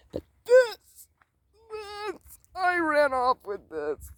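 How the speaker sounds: playful high voice at a thieving pet. Real, not acted. Vlogger teasing her dog in a high playful voice.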